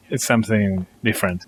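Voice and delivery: ordinary talk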